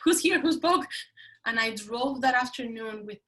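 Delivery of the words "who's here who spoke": higher, louder mock alarm through laughter; mock startled. Guest retelling her shock at her own bold words, mock startled. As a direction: act baffled at who just spoke.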